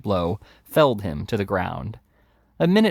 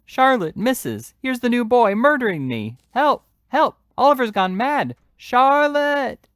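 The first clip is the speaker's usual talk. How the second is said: much higher, loud cries for help; panicked shouts; 5 dB louder than usual. A narrator's reading. Noah on the floor shouting to Charlotte for help. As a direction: scream for rescue in a panic. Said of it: "Noah's shouts".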